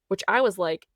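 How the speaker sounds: normal talking voice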